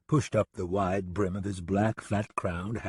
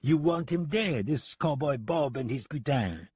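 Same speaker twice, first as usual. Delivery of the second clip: slow, high, French-accented mock surprise; feigned surprise; 6 semitones higher than usual. French gunman echoes deputy's kill order, feigned surprise. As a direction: act astonished in a French accent. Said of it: "feigning surprise".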